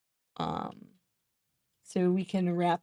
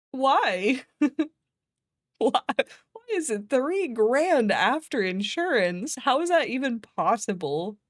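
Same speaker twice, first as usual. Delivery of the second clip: incredulous; 5 semitones higher, slower than usual. Retold or imitated. Incredulous question about cost.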